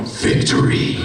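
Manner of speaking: gloating whispered cry of victory; hammed-up gloat; mock villain triumph. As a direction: crow it like a cartoon villain. Gloating at snobbish fans.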